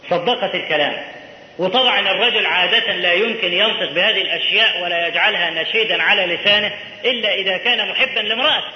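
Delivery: usual speaking voice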